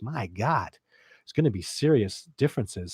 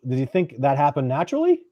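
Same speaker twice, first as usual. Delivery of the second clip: ordinary delivery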